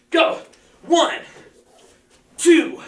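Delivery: loud, high counting of reps; energetic coach calling out reps; shouted count. Real, not acted. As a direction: bark out each number to drive the class.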